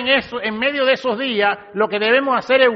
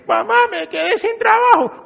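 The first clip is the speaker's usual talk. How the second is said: much higher, plaintive son's cry; imitating a distressed son; 11 semitones higher than usual. Son telling his mother in Mexico he lost his job, pleading high. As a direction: pitch it up like a son in trouble calling home.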